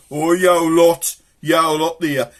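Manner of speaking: loud, high, drawn-out mock telling-off; cross grown-up calling out. Grown-up to noisy kids in the street, cross holler. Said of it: "one of the grown-ups would come out".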